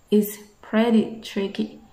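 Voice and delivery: "It's pretty tricky" spoken very slowly, syllable by syllable; slow drilled repeat. Shown as a demonstration. Teacher slowly drills the phrase for students. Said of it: "on peut dire aussi".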